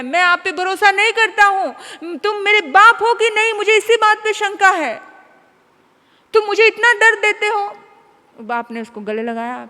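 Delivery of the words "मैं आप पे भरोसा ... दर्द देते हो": loud, higher anguished accusation; the hurt son shouting at his father; a mild mock wail of pain; crying yell. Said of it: "जोर से रो रहा है, चिल्ला रहा है".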